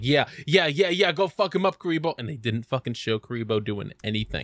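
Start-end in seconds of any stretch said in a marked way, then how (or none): 0.0-2.1 high, eager hype-up; retold fan egging on the fighter; excited cheering on. Recounting his cheer for Kuribo's attack, excited and high. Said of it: "and I was like".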